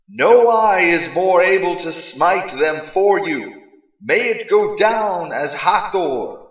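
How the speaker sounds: high, loud, slow divine council; a booming, urgent chorus of gods. Acted. Council urges Ra.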